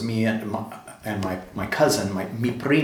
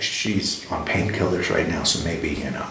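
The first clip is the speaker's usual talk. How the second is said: husband aside; 7 semitones lower than usual. Retold or imitated. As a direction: drop low and confide it. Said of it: "he's like".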